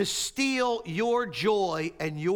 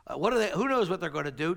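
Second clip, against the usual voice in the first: normal talking voice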